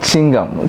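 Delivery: "chewing gum" clipped to "ching-gum"; old-way chewing gum. Shown as a demonstration. Teacher showing the old way, "chewing gum" as "ching-gum". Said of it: "the old way of saying".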